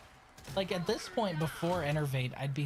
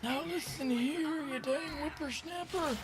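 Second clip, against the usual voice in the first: put-on old prospector; 12 semitones higher, 5 dB quieter than usual. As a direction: scold like a cranky old geezer. Old man voice lecturing youngsters.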